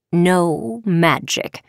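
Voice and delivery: very slow, final pronouncement; firm, drawn-out verdict; stern command. Acted. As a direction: lay down the ban word by word. Professor firmly repeats the ban.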